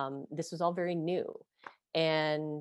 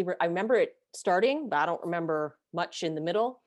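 usual speaking voice

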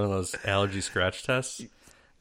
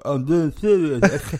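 slow, loud, slurred mock lisp; high, garbled thick-tongued imitation; swollen tongue; 7 semitones higher, 7 dB louder, slower than usual. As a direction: insist with your tongue filling your mouth. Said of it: "My tongue is swelling up".

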